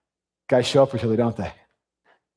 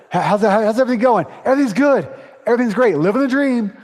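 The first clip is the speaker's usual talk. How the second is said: mock bright, higher and louder small talk; fake cheerful; 7 semitones higher, 4 dB louder, faster than usual. Men faking fine to each other, hammed-up cheer. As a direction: play the chipper everything's-great guy. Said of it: "We always do that stuff".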